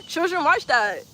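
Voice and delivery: ordinary delivery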